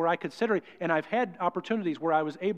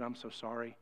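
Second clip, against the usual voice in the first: very soft, head hung; ashamed quiet apology; 6 semitones lower, 13 dB quieter than usual. Retold or imitated. Doctor Gibbs apologizing to the pilot, soft and ashamed. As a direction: murmur it in shame.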